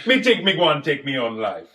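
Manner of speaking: mock Jamaican accent. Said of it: "Jamaican accent".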